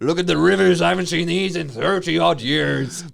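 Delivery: high, loud mock wonder; raving trucker. Trucker showing officer the rivers, hammed-up raving. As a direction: rave loudly like a deluded ice-road driver.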